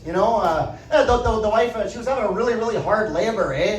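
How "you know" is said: normal talking voice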